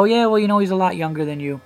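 normal talking voice

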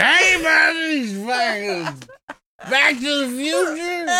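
put-on high slow drunk bum shout; George Buck Flowers. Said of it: "You're like George Buck Flowers over here".

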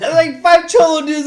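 can't stop laughing while telling it. Real, not acted. Laughing while retelling story.

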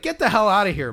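retold exasperated outburst; imagined irritated dismissal. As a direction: act out shooing off a pest. What he wanted to tell him.

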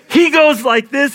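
ordinary delivery

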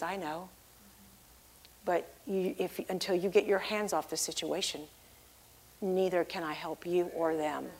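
very soft and low, with pauses; quiet, patient reply. Retold or imitated. God telling the believer to let go, hushed and patient. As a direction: explain gently in a hushed low voice.

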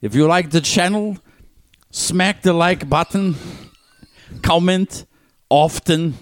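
high, slow mock Russian accent. Podcaster as Putin hosting a travel show, Russian accent. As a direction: play a Russian leader as vlogger, heavy accent. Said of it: "Did you watch his traveling shows?".

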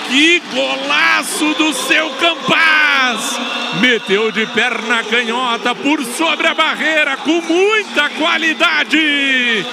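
shouted, slow-drawn celebration; explosive goal commentary. Real, not acted. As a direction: roar praise over the goal. Celebrating the goal just scored. (narração eufórica de golaço)

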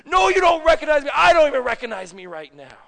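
loud, angry comeback; heated, high-pitched retort; mad denial. Acted. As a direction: shout them down in anger, starting high and dropping. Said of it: "he got so mad".

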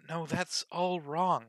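slow exasperated correction. Acted. Alice catching her mistake.

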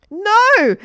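very high, loud "no"; horrified cry. A narrator's reading. Margaret sees her teacher knocked out, horrified. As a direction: cry out in alarm.

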